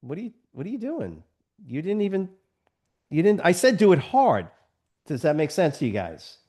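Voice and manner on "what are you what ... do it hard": mock exasperated coach. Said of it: "I'm like".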